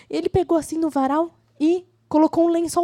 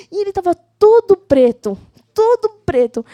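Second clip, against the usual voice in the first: emphatic storytelling; 5 semitones higher, 9 dB louder than usual. A narrator's reading. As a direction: punch it for the kids. (ênfase de contadora de histórias para crianças)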